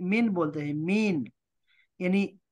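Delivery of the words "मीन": ordinary delivery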